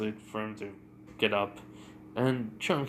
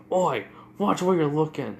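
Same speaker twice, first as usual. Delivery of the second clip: raised, gruffly annoyed call, higher in pitch; irritated warning; 4 semitones higher, 4 dB louder than usual. Retold or imitated. Bakugo bumps into a stranger, irritated warning. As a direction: call out at whoever bumped you.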